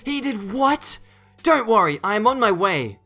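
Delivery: high, loud outraged disbelief; startled high voice, then urgent assurance; shocked mum. A narrator's reading. Mum shocked by monitor's accusation.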